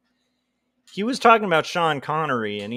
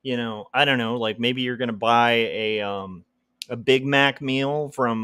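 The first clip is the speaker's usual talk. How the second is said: usual speaking voice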